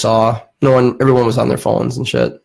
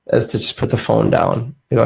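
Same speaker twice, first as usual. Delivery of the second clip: normal talking voice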